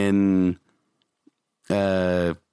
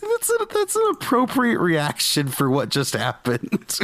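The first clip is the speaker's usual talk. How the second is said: higher-pitched, laughing delivery; talking through laughter; 8 semitones higher than usual. Real, not acted. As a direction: barely hold back the laugh while talking. Laughing as they retell their reaction.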